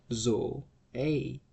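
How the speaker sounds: Zoe as two syllables, zo-E; quiet demo of Zoe; model pronunciation. Shown as a demonstration. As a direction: say Zoe softly, both vowels apart. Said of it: "the right pronunciation is".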